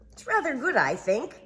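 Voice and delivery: high, pleased fussy rabbit. Acted. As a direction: admire it in a prim high voice. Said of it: "admiring his work".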